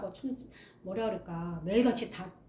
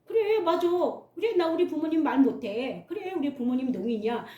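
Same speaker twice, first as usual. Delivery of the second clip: defiant acceptance; 6 semitones higher than usual. Retold or imitated. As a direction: say it boldly, head up. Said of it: "이렇게 인정하면은".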